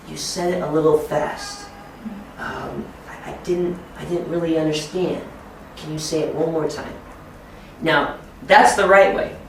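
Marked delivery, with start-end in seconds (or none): 0.1-6.9 softly, as if into the teacher's ear; a discreet, hushed request; quiet aside. Shown as a demonstration. Assistant quietly asking the teacher for help, softly. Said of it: "and quietly say to me, maybe in my ear".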